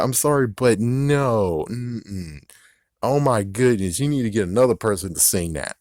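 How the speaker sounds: usual speaking voice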